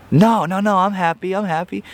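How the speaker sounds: high, insistent fake happiness; a hammed-up brave face; forced cheer. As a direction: protest too much that you're happy.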